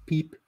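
"Peep?" with a long ee vowel, rising; pipe said as peep. Shown as a demonstration. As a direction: try "Peep?" as French pipe, questioning. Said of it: "Is that how you say pipe?".

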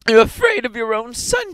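high, loud shrieked accusation; struggling cry. A narrator's reading. Wife struggling against her fearful husband, high cry. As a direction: shriek it at him while pulling away. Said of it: "she cried, struggling".

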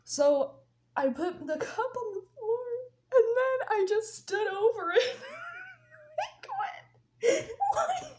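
high voice breaking into helpless laughter; barely getting words out for laughing. Real, not acted. Storyteller losing it over the cup on the floor, laughing. Laughs at her own mistake.

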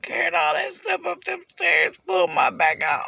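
loud, fed-up outburst about the stairs; exasperated shout. Real, not acted. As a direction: yell it out, sick of it all. Venting about pulled back.

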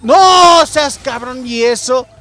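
loud high drawn-out protest; shouted slow outrage; outraged yell. Real, not acted. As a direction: yell it at the game, slow and stretched. Angry at game hit.

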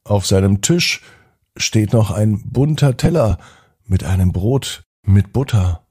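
plain reading voice

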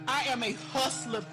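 ordinary talk, nothing marked

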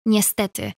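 ordinary delivery, nothing marked

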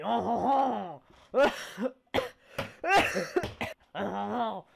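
loud, high mock villain laugh; hammed-up evil laugh. Streamer greeting viewers with a cartoon villain laugh. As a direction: ham up a cartoon villain's chuckle.

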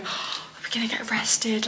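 scared, hushed whisper. Retold or imitated. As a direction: whisper it like you're afraid of getting caught. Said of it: "I was whispering to him".

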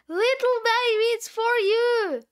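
high sing-out to someone in another room; calling out. Acted. Calling the baby to the door.

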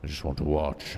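normal talking voice

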